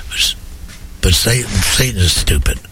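usual speaking voice

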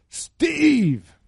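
high, loud shout of a name; megaphone call. Retold or imitated. Man outside calls Steve out of the camper, high loud shout. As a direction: call the name out across the camp through a megaphone. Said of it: "used his little megahorn".

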